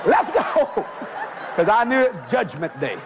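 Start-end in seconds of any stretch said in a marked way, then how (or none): 0.1-0.9 high-pitched urgent begging; mock desperation. Boy begging for rapture before judgment, mock desperate. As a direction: plead high for an immediate escape.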